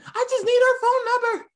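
put-on very high, strained kid squeak; pleading boy's squeal, pitched far up; high excited kid voice. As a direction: imitate a son whose voice flies up. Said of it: "my voice goes high".

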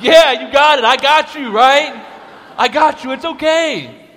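high, loud, laughing delight; laughing, overjoyed reassurance. Real, not acted.

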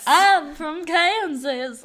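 high, loud, slow Southern-belle twang; exaggerated country Kansas drawl; mock Kansas accent. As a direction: drag it out in a fake Southern accent. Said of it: "I don't think they talk like that".

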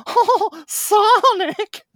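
very high, loud calling yell; yelled at the top of his lungs. Acted. Young fox calling out to his hero, high loud yell. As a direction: shout for Sonic across the hill with everything you've got. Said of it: "at the top of his lungs yelled out".

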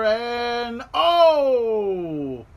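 loud, high, drawn-out "oh"; excited outburst. Real, not acted. Fan reacting to a tombstone pile driver, excited outburst. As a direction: cry out at a shock move in the ring.

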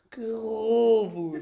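slow loud mock outrage; indignant exclamation. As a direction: stretch it slowly, outraged at being robbed. Complaining about being overcharged.

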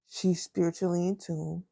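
normal talking voice

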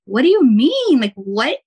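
sudden high, loud what do you mean; startled disbelief, then trailing off; retold confusion. Husband says there's a halo, answered in startled surprise. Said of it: "And I'm like".

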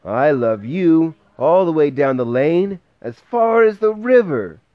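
a little hare calling out as far as he can; excited cry. A narrator's reading. Parent reading, little hare crying out excitedly. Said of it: "cried Little Nut Brown Hare".

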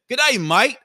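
a loud, high mock Australian greeting; a hammed-up Australian accent. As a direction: toss out a cheesy Australian "Good day, mate". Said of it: "my first thought was to say".

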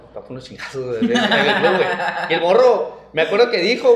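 laughter spilling over the story; laughing while telling. Real, not acted. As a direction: crack up as you retell it. Laughing retelling a threat.

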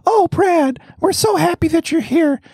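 high-pitched mock gushing welcome; syrupy mock hospitality; gushing host. Mocking a gushing tea host.

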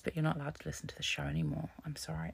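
fast hushed whisper of mock bad news. Host whispering fake bad news to her listeners, hushed. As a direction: breathe it out like a guilty secret.

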